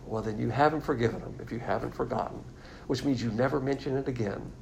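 usual speaking voice

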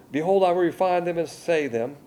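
loud, declaiming reading of scripture; forceful scripture reading. Acted. Preacher rereading the verse to his congregation, loud declaiming. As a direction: thunder the verses out.

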